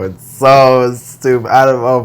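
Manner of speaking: loud. Real, not acted. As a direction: lean on "so" and let it out loudly. Venting about online school.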